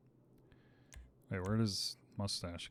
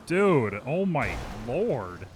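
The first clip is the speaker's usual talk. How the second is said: exasperated outburst; 2 semitones higher, 9 dB louder, slower than usual. Real, not acted. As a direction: exclaim loudly in overwhelmed disbelief. Reacting to chaos in game.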